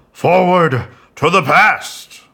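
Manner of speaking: loud, high, slow Doc Brown voice; Christopher Lloyd. Pretend. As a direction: bellow it like a frantic Doc Brown. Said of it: "that hurt my ears doing Christopher Lloyd".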